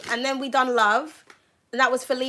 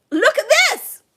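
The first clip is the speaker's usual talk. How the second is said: much higher, louder delighted cry; excited cry; 12 semitones higher, 5 dB louder than usual. Real, not acted. To the viewer, over the card, an excited higher louder cry. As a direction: shout it out, thrilled by the card.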